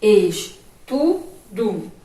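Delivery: "estudo" in slow, separate syllables, ending "doo"; final O as U. Shown as a demonstration. Teacher showing pupils how it is pronounced, "estudo" syllable by syllable ending in U. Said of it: "quando a gente foi pronunciar".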